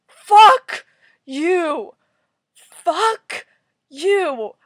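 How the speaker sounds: loud, high, halting shouts; seething, spaced-out outburst; enraged cursing. Real, not acted. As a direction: yell one word at a time. Cursing the comic character.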